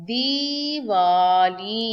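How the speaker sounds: slow, loud दिवाली with a short i; slow demo of the wrong दिवाली; wrong spelling read out. Shown as a demonstration. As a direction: drag out दिवाली to expose the misspelling. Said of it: "यह है wrong spelling".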